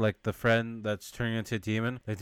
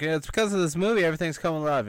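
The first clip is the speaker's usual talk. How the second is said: excited; 5 semitones higher, 4 dB louder, faster than usual. Retold or imitated. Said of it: "one of the girls is like".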